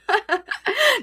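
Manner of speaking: short high burst of laughter; quick high chuckle. Real, not acted. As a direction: laugh briefly at your own line. Laughs at own acted threat.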